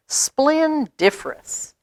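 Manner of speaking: grand flourish. A narrator's reading. Fancy word finale.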